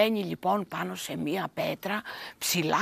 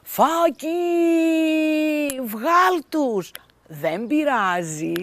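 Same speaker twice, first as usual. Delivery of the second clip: higher, louder call to Faki; 7 semitones higher, 7 dB louder, slower than usual. A narrator's reading. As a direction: call out to her, pleading. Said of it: "Μα η Φάβα της φωνάζει".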